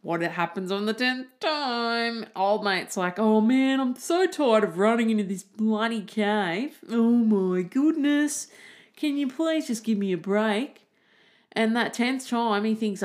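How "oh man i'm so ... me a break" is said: sick of it all, groan it out; fed-up whine. Acted. Weary man complaining about running to the cave again, exasperated whine.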